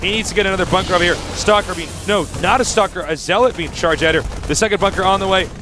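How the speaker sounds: high, urgent esports casting; excited play-by-play. Acted. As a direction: call the fight as it builds.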